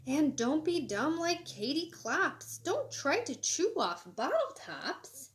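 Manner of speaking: lively, sing-song storybook reading; animated picture-book read-aloud; reading to children. Acted. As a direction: read it bright and bouncy for kids. Reads the moral lines.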